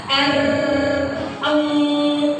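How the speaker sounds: "R" rolled out long and distinct; clear consonants. Shown as a demonstration. Trainer demonstrates sounds to trainees, clear consonants. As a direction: sustain "eng" clearly. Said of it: "itu harus jelas".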